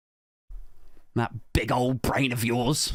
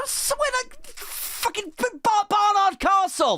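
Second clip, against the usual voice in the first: excited outburst. Acted. Comic pitches Barnard Castle as the venue, excited outburst. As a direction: blurt it out high and excited, tripping over words. Gleeful punchline idea bursts out.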